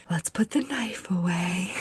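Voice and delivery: imitated cautious near-whisper; hushed calming. Professor to frightened student holding a knife, hushed and cautious. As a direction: whisper it carefully, as if talking someone down.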